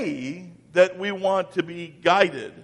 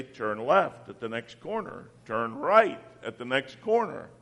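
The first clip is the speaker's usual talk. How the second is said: lower, softer machine-like directions; GPS voice; 6 semitones lower, 6 dB quieter than usual. Retold or imitated. Preacher imitating the car GPS voice, lower and softer. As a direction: read it like a car navigator. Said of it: "computer thing that would sit in your car and say".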